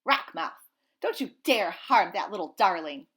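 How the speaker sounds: put-on loud, protective old goose shout; fierce goose shout. Old goose shouts at the fish to protect the gosling. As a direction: bellow it to drive off the fish.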